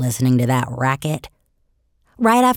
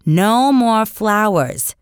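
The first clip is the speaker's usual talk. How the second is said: slow, firm warning, each word weighed; stern mother; 5 semitones higher, 3 dB louder, much slower than usual. Acted. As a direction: lay down the law to a toddler. Mother scolds toddler Fudge.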